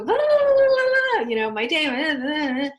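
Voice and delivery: high, loud mock blabbering; mimicking the chatty wife. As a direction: rattle it off like a wife bursting to talk. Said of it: "She just wants to go".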